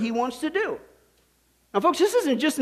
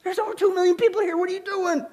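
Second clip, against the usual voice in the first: higher, fast incredulous plea; exasperated Moses; 8 semitones higher than usual. Retold or imitated. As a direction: throw up your hands at God. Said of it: "So Moses said".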